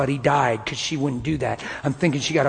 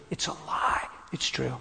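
softer whispered back and forth; 9 dB quieter than usual. Retold or imitated. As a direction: fade the argument to a whisper. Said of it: "I said".

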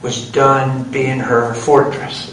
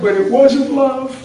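high, slow imitation of weeping speech; drawn-out, high-pitched tearful voice; tearful confession; 8 semitones higher, 3 dB louder, slower than usual. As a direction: keep up the wife's mock-crying wail. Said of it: "She said".

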